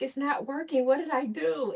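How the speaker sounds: panicked inner voice. Retold or imitated. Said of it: "in my head".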